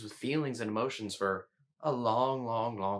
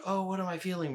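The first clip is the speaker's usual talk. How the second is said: higher-pitched imitation of inner wondering; lightly mocking, high-pitched musing; mock self-questioning; 8 semitones higher, faster than usual. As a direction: play someone lost in their own head. Imitates someone stuck in their head.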